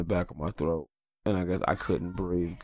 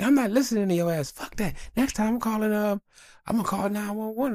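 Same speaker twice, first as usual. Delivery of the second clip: much higher hammed-up nag; the wife scolding him about calling 911; mock mad wife; 13 semitones higher than usual. High mock angry nagging. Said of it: "Then she got mad".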